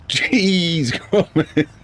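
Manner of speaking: high cartoon inventor exclamation breaking into laughter; playful high-pitched character impression; Wallace. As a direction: stretch the first word high, amused. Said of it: "almost sounded like Wallace saying".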